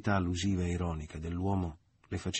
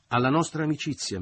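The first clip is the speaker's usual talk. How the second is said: higher, louder toast to friendship; raised toast; 8 semitones higher, 6 dB louder than usual. A narrator's reading. Leo toasting Michele.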